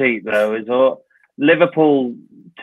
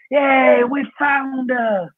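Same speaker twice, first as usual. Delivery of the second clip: high heckler's yell, stretched out; imitated scream; 8 semitones higher, much slower than usual. Man in audience yells at stage, imitated scream. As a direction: holler it slowly in mock triumph. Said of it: "he screamed at the".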